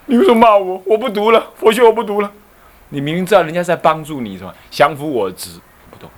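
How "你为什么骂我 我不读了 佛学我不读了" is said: offended retort. Retold or imitated. Offended student retorts to scolding.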